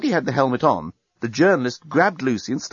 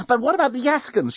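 high-pitched, anxious objection; urgent protest; 13 semitones higher than usual. A narrator's reading. Lucy pushing the journalist away, protesting. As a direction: protest in a much higher voice. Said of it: "she protested".

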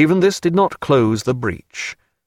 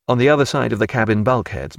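ordinary delivery, nothing marked

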